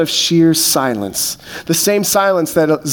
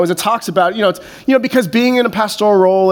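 usual speaking voice

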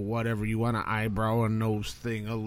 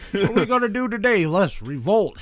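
mock eager voice; 7 semitones higher, 5 dB louder than usual. Mocking Texans wanting revolt.

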